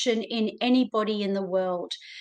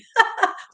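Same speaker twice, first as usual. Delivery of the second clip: breathy self-mocking laugh; 12 semitones higher, 4 dB louder than usual. Real, not acted. Laughs at not singing.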